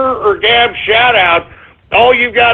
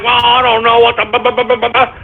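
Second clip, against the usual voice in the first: stumbling and mumbling; 2 semitones higher than usual. Retold or imitated. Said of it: "stumbling and mumbling over his own tongue".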